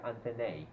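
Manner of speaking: antennae said an-TEN-ay, ending rhymes with day; Australian antennae. Shown as a demonstration. Teacher repeats the Australian antennae. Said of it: "if you go to Australia".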